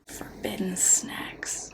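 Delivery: slow dramatic whisper. Shown as a demonstration. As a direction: breathe it out like a secret. Whispered merch slogan.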